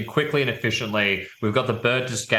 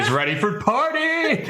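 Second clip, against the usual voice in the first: frat bro; 12 semitones higher than usual. Retold or imitated. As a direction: whoop it up like a frat bro on holiday. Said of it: "sounds like you're a frat bro".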